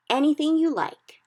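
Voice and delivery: high, loud boast; proudly. A narrator's reading. The BFG boasting to Sophie, proud. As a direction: say it with pride in his skill. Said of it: "the B F G said proudly".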